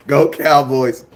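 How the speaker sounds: ordinary talk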